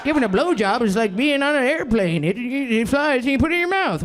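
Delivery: hack comic voice. Retold or imitated. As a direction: ham up a cheesy one-liner. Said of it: "I'm not Mark Normand up here".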